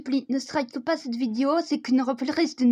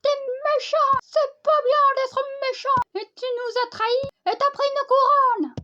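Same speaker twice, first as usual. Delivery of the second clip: put-on much higher, whiny child accusing; high childlike accusation; childish voice; 9 semitones higher, faster than usual. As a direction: sob it out like a betrayed kid.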